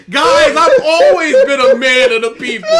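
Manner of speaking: hammed-up proud declaration; mock noble speech. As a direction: puff up like a self-declared people's champion. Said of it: "and I just like".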